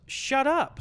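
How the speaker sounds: put-on sudden high-pitched storybook kid; kid talking back. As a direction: imitate a kid snapping at a parent. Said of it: "the kid goes".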